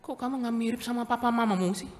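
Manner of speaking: high, fast mock disbelief; mock surprised teasing. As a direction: tease like a nosy neighbour. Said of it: "Tapi kalau lihat Harden".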